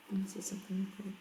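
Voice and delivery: quick whispered aside. Real, not acted. Teacher whispers to herself while checking the recording, quick whisper. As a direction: whisper it fast, off mic.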